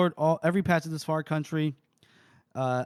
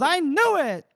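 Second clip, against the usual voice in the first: high, loud cry of vindication; triumphant outburst; 12 semitones higher, 5 dB louder, slower than usual. Acted. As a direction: crow it out in theatrical triumph. Casca's triumphant outburst, acted.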